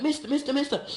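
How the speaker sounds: put-on high childish pleading; small boy begging a stranger for money; little boy voice. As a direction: squeak it like a small kid tugging a leg. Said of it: "and went".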